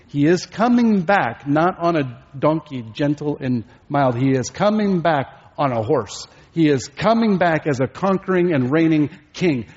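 emphatic, rising proclamation; forceful preaching. Real, not acted. Preacher promises the congregation a victorious warrior, proclaiming forcefully. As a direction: drive home each repeated phrase with force.

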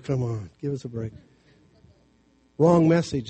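ordinary talk, nothing marked

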